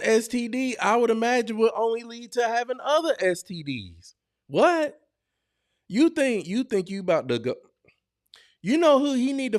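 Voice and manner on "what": ordinary talk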